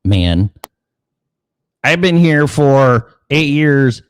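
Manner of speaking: the senior dog laying down the law, drawn out; indignant old dog. Retold or imitated. Old dog telling off the newcomer, high and slow. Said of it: "dog with equity's like".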